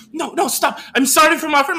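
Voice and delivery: flustered apology. Retold or imitated. Acting Alma apologising for friend.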